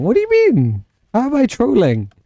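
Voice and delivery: indignant. Real, not acted. Mock indignation at chat.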